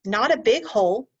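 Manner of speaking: usual speaking voice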